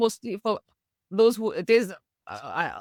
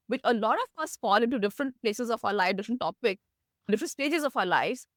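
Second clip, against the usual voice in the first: ordinary delivery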